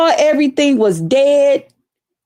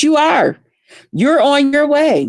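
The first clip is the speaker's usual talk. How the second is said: ordinary talk, nothing marked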